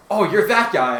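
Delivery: bright friendly callout; excited recognition. Acted. Kirishima greets Kudai excitedly.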